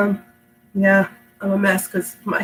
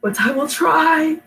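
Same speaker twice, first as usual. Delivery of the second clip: slow, higher, determined try; sick voice; 9 semitones higher, slower than usual. Real, not acted. Sick reader promising viewers she'll still try. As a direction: push through a sick voice. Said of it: "me sounding like this".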